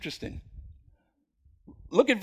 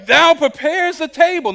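higher, louder proclamation of the verse; first take; 7 semitones higher, 6 dB louder than usual. Shown as a demonstration. Preacher first proclaiming the psalm, high and loud. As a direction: ring it out high and strong. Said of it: "Now listen".

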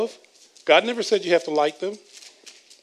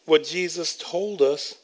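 ordinary delivery

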